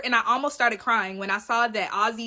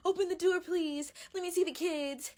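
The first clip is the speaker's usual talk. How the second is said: pleading mother; 5 semitones higher, 8 dB quieter than usual. Retold or imitated. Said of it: "it's giving very much".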